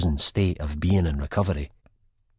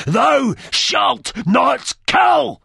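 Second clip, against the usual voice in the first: higher, louder, slow fanatic scream; 8 dB louder, much slower than usual. A narrator's reading. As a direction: scream it in his face, drawn out. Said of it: "Then he screams in my face".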